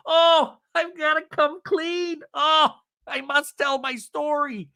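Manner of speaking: high, drawn-out mock confession; Sandovalian dramatics. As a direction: declaim it like a martyr coming clean. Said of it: "I feel like it's very Sandovalian".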